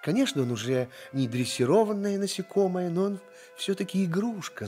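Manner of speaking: ordinary delivery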